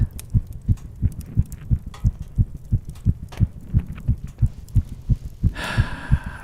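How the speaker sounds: a long, audible exhale to model calm breathing; calming deep exhale; slow deep breath. Acted. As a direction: breathe out slowly for her to follow. Calming her panic attack.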